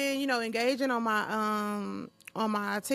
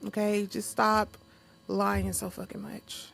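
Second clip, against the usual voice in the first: usual speaking voice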